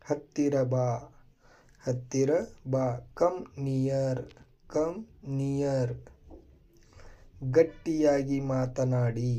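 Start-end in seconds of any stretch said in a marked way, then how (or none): none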